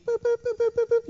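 high beeping boops; mock detector beeps sweeping the beach; metal detector beeps.

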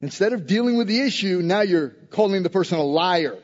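ordinary talk, nothing marked

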